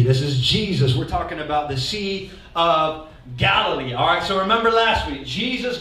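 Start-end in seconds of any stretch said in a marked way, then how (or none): none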